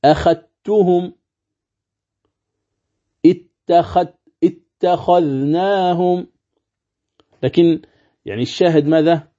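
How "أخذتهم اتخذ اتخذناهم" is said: أخذتهم slowly as akhattuhum, dhal merged; Warsh recitation demo. Shown as a demonstration. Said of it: "هكذا".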